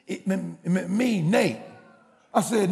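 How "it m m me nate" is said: imitated stammer before the name; stuttering son at the door. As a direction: stammer out who you are. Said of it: "you know he stuttered".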